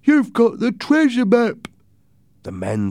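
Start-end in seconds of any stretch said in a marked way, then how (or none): 0.0-1.7 high, loud excited cry; pitched way up in astonishment; amazed sailor. Acted. George seeing the treasure map, loud and amazed.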